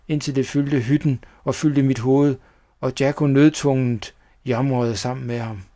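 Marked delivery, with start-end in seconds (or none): none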